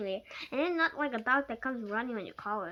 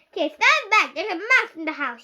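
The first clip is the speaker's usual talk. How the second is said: higher, loud frantic warning; screaming; 6 semitones higher, 12 dB louder than usual. Acted. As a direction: shout wildly with the broom raised. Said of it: "she screamed".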